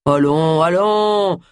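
admonishing. A narrator's reading. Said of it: "admonesta-t-il".

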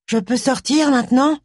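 high, plaintive little question. A narrator's reading. Hidden magician asks to come out, high plaintive voice. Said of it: "fit une voix plaintive".

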